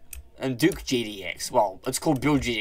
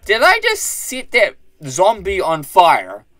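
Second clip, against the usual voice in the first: loud, high, badly faked cartoon voice; terrible Patrick; 8 semitones higher, 10 dB louder than usual. Gamer puzzled by underwater fire, bad cartoon impression. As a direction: put on a hammed-up, baffled impression at high volume. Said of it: "that was a terrible Patrick impression".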